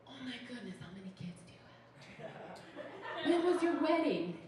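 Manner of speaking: hammed-up amazed prying; mock astonishment. Mock astonished response to being twenty.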